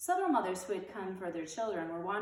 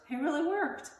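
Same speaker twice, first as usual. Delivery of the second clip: delighted; 9 semitones higher, 6 dB louder than usual. A narrator's reading. Beezus delighted with herself.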